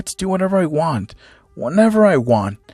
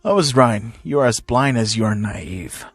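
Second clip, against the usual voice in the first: low, smug put-down; grinning villain; 6 semitones lower, 4 dB quieter than usual. A narrator's reading. Hisashi belittles his son, grinning and low. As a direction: drop low, quietly gloating. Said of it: "grinning, saying".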